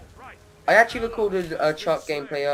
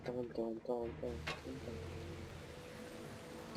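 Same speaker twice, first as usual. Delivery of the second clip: urging under breath; 17 dB quieter, slower than usual. Real, not acted. Willing a play to succeed.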